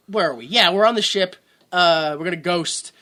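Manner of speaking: normal talking voice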